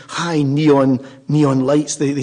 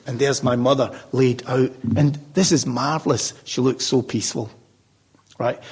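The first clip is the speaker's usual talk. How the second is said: normal talking voice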